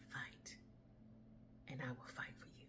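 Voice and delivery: quick soft whispered promise; small whisper. A narrator's reading. Small voice whispering to the woman who gave up. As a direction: breathe it gently. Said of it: "the small voice said".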